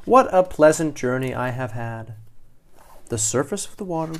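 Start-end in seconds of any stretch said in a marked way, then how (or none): 0.0-2.2 delighted cry. A narrator's reading. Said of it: "she cries".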